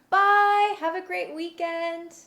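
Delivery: bright high sign-off; cheery goodbye. Real, not acted. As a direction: wave them off cheerfully.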